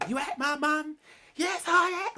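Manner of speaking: hammed-up soap screaming match; Cat Slater. Parodying a public row in EastEnders, shrieking melodrama. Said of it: "like, Cat Slater in EastEnders".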